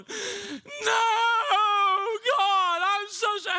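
high, loud, hammed-up wail; mock weeping. Teen left behind begging God, hammed-up weeping. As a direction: bawl to heaven in exaggerated repentance. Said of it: "While I'm weeping crocodile tears".

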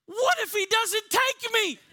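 high, loud, drawn-out mock panic; panicked child. Kid terrified by his bedtime prayer, mock panic. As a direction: wail it slowly like a frightened kid.

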